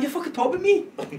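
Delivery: confronting a woman gossiping in the gym, angrily; angry retold accusation. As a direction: snap it at her, furious.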